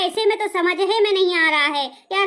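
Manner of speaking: normal talking voice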